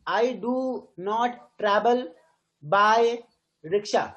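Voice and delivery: deliberate, broken-up reading of the line; word by word. Shown as a demonstration. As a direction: read it out one chunk at a time, pausing. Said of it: "I तो नहीं बोलते ना".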